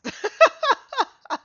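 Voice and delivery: high laugh burst. Real, not acted.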